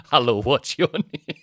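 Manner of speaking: a playful parody of a cheesy host; mock stage host. Comedian mocking his stage persona, slow compere greeting. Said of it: "you know I don't go on and say".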